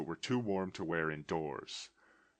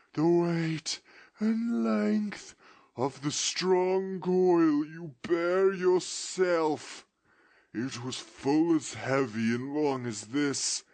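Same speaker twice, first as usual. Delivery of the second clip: slow, high, loud mournful wail; ghost's voice; 8 semitones higher, 7 dB louder, slower than usual. Acted. Ghost lamenting its fate to Scrooge in a slow wail. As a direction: lament your doom from the grave.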